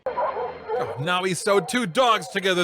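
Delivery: ordinary delivery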